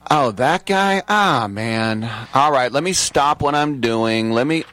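a hint of a grudging star dropping everything; reluctant comic. Host imagining annoyed comics getting his ticket request, mock put-upon.

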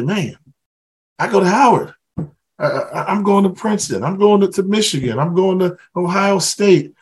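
high, excited boast about the school; proud student. Pretend. To students, voicing a proud freshman, high and excited. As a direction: puff up with pride at getting in.

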